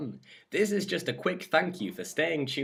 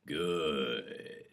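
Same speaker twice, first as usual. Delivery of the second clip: low, softer, self-satisfied approval; understated smugness; complacent; 9 semitones lower, 5 dB quieter than usual. A narrator's reading. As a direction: say it complacently, pleased with the answer. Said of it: "he says complacently".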